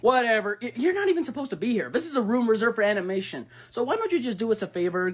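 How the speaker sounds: ordinary delivery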